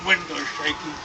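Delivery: plain reading voice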